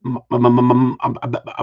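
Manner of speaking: cold jaw trembling over each first sound; chattering stammer. Real, not acted. Host too cold to talk tells viewers through chattering teeth. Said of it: "My teeth are chattering".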